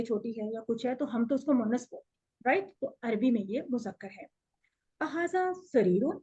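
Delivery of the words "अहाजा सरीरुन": slow "अहाजा सरीरुन" with rising lilt; questioning tune. Shown as a demonstration. Teacher shows how to ask a question, questioning tune. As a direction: ask "अहाजा सरीरुन" slowly, lifting the end. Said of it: "ऐसे सवाल करेंगे".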